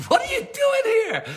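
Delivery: very high, drawn-out squeal of surprise; astonished shout. Retold or imitated. Speechless son finding his dad at work, astonished squeal.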